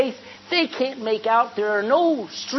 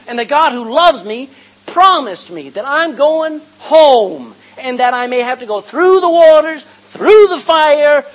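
preaching at full force; 4 semitones higher, 11 dB louder than usual. Acted. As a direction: lift the congregation with a rising cry.

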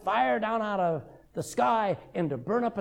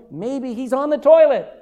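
loud, higher-pitched jeer; mocking taunt; 4 semitones higher, 9 dB louder than usual. Elijah taunts the false prophets, loud mocking. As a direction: taunt them with mock helpfulness. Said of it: "Elijah starts to laugh".

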